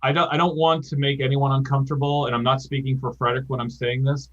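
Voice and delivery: normal talking voice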